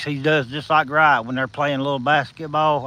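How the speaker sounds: normal talking voice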